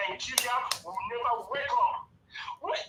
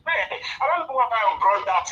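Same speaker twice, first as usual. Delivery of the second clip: usual speaking voice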